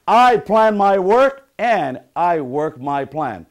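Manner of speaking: loud, slow motivational chant; emphatic affirmation. Shown as a demonstration. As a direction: drive each word home for the group. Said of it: "second affirmation I want you to say".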